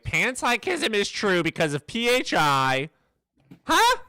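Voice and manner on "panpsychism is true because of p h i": mocking voice. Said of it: "Dude's gonna be like".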